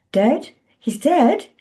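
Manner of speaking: high stunned disbelief; shocked disbelief. A narrator's reading. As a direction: gasp it out in shock. Shock at news.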